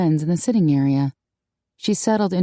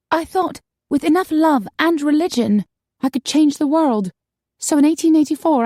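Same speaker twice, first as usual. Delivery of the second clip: put-on higher, brighter girlish voice; high young woman voice; 8 semitones higher, 3 dB louder than usual. A narrator's reading. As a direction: play the upset young woman. Maggie's story.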